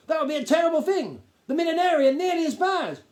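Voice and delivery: high, loud, self-important Duke lecturing; a pompous gnome lord talking down to the boy. Acted. Duke's pompous speech.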